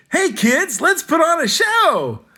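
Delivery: very high, loud, slow showbiz cheer; gleeful stage kid. Retold or imitated. Comic quoting a stagey kid, high and hammy. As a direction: belt it like a kid putting on a play. Mock theatrical kid show call.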